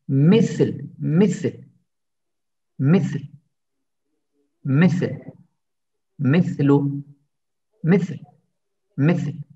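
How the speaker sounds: each misil as mi-thil, tongue out; lisped th drill. Shown as a demonstration. Teacher drilling misil for students, lisped th. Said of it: "peltek bir ses gitmesi gerek".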